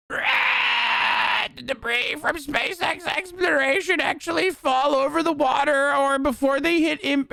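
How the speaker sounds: high, fast, nasal-free squeaky nerd mock; mock the nerds with a high excited voice; nerdy know-it-all voice. Mocking chat's nerds who corrected him, high squeaky know-it-all voice. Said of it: "And the nerds were like".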